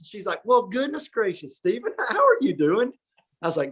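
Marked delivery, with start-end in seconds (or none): 0.4-2.9 high, gushing welcome in a put-on voice; delighted woman's greeting, imitated. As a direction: play the pleasantly surprised hostess, pitched well up. Voicing friend's relative greeting him.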